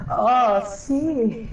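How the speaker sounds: very high, drawn-out, very slow breathy-sounding oh sí; kinky Spanish voice line. Retold or imitated. Imitating the Spanish game voice, slow and high. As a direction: stretch it out way up high, hamming the sultry quote. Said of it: "So it sounds so wrong".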